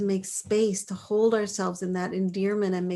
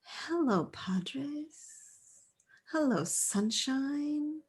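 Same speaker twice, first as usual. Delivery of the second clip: soft, higher, half-whispered tender hello; self-compassionate greeting; 5 semitones higher, 6 dB quieter, much slower than usual. Shown as a demonstration. Coach shows self-compassion in action, tender hushed greeting. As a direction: greet your own body gently and slowly. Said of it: "It looks like going".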